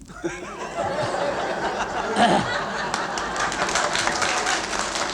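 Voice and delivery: wheezing laugh at his own joke; breathy silent laugh. Real, not acted. Comedian wheezes with laughter at his own tea joke. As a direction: shake with unvoiced laughter.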